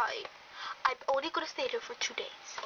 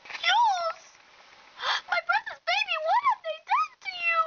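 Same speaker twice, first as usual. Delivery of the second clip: very high, tearful, gasping lament; horrified wailing; 3 dB louder than usual. Acted. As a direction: wail it high as if tragedy struck. Horrified at sick relative.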